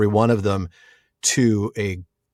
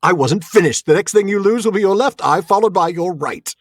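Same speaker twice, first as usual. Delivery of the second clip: commanding, raised-pitch theatrical threat; Cary Elwes; 7 semitones higher than usual. Acted. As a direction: cut in with dramatic menace. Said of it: "a masterful Cary Elwes impression".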